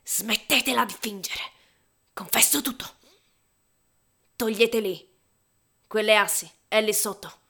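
loud, high, desperate yells; frantic shouting. Acted. Narrator shouting his confession at the policemen. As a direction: confess at the top of the lungs.

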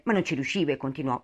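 ordinary delivery, nothing marked

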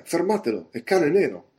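high, urgent shout; shouted alarm. A narrator's reading. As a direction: cry out to raise the alarm.

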